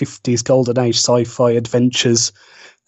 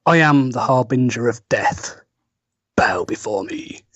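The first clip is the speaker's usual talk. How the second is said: dark lord. Acted. Penguin guest answering as doom figure.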